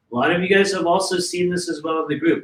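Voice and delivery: ordinary talk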